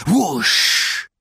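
loud whooshing sound. Acted. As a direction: blast out the rushing train. Train rushing by.

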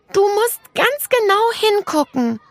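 a hidden tiny creature answers Nele in a high, piping voice. A narrator's reading. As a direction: pipe it like a minuscule child. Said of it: "sagte das Stimmchen".

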